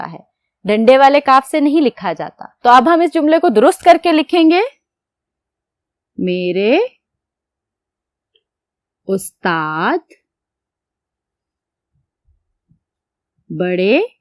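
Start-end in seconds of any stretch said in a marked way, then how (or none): 6.2-14.1 very slow, softer, word by word; dictating मेरी भावज; dictation pace. Shown as a demonstration. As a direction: spell it out slowly for the class to write. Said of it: "आप मेरे साथ साथ लिखें".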